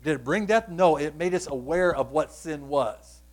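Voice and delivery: usual speaking voice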